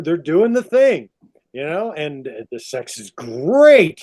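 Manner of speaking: ordinary talk, nothing marked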